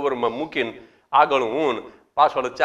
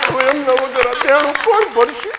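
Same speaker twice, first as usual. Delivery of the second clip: higher, louder weeping widow; mock wailing widow; 8 semitones higher, 6 dB louder than usual. Widow crying to the president about the debt, loud mock wail. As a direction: wail it out in hammed-up grief. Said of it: "તો રોતા રોતા મને કે".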